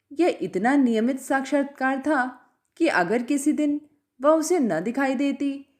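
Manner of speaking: usual speaking voice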